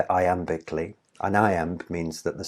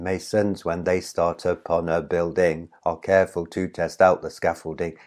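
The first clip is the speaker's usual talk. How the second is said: "Masons" as maSONS, every second syllable thumped; boring iambic singsong. Shown as a demonstration. Teacher showing how not to read poetry, mechanical iambic beat. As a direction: plod mechanically through "Masons, when they start upon a building" in weak-STRONG beats. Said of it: "boring if you just say it all in iambic".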